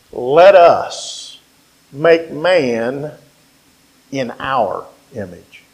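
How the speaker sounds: low, soft, spaced-out phrases; quiet, reverent preacher quoting God; hushed scripture quote. Acted. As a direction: drop low and let each phrase sink in.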